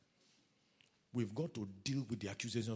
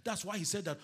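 ordinary delivery

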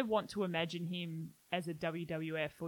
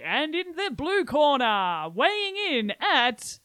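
loud, slow ring announcer; wrestling announcer; 7 semitones higher, 9 dB louder, slower than usual. Acted. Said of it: "so in wrestling they say".

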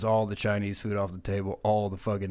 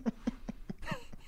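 high breathy giggle; 12 dB quieter than usual. Real, not acted. Laughing at own bit.

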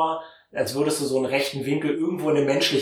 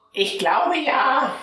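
very high, slow auntie voice; high and drawn out, unsure; Tantchens Stimme; 12 semitones higher, slower than usual. Acted. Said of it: "dann sagt sie". (hohe, gedehnte Tantenstimme)

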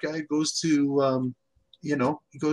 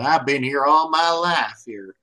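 mock Southern accent; slower than usual. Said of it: "some guy with a Southern accent".

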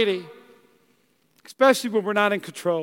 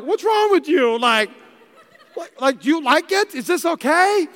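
higher-pitched, mildly bewildered retold questions; baffled questioning; 5 semitones higher than usual. Husband to suddenly crying wife, acted bafflement. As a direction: act out a confused husband checking in.

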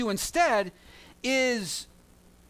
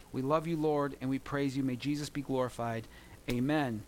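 prayer; 4 semitones lower than usual. Acted. Pastor leads closing prayer.

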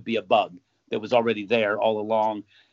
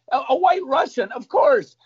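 triumphant gotcha; 13 semitones higher, 5 dB louder than usual. Acted. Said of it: "what are you drinking".

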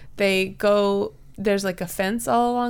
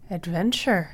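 eerie ether voice; 3 semitones lower than usual. Acted. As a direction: intone it like a ghostly presence. Eerie ether voice calling adventure.

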